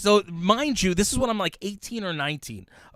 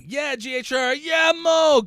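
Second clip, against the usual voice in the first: excited greeting; 10 semitones higher, 7 dB louder, slower than usual. Real, not acted. Greeting viewer.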